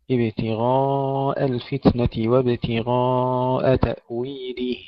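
slow recitation, ابتغاء with long dark غا and thin hamza; heavy ghayn, light hamza. Shown as a demonstration. Tajweed teacher reciting the example, slow drawn-out ابتغاء. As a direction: stretch ابتغاء, heavy then suddenly light. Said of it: "بعد تفخيم الغين والألف".

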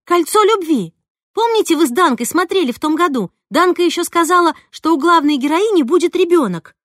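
loud, high, pressing reminder; urgent excitement. A narrator's reading. Narrator reminds Roza of the TV serial, excited and urgent. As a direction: press her eagerly to remember.

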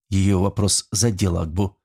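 ordinary delivery, nothing marked

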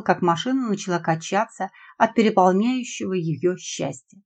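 ordinary delivery, nothing marked

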